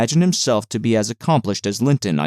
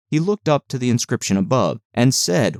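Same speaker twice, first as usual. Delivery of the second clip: plain reading voice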